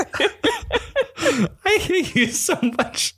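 very high squeal of laughter; helpless laughing. Real, not acted. Spouse teased over pronunciation, helplessly laughing.

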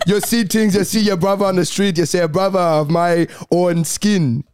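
lilting, existential Jamaican impression; Jamaican accent. Shown as a demonstration. Showing how Jamaican gets existential, Jamaican accent. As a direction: muse philosophically in a Jamaican accent. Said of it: "Jamaican normally ends up being very existential".

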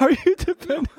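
high laughing question. Real, not acted. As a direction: ask through a laugh. Laughing at partner's rant.

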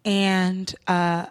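low, measured, earnest address; very chill speech. Retold or imitated. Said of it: "very chill words".

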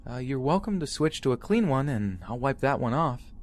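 high, polite customer service voice; sweet, high-pitched service voice. Retold or imitated. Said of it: "Trying to use my best customer service voice".

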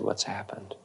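hushed whisper; whispered question. Retold or imitated. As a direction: ask gently under the breath. Teacher asks softly after rage dies.

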